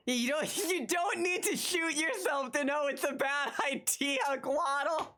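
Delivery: laughing through the line. Real, not acted. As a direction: read it out while cracking up. Laughing at a chat message.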